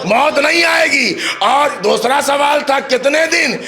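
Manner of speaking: soar and hold the words like a majlis orator; preaching at full force. Acted. Preacher to the majlis audience, raising the story to a sung climax.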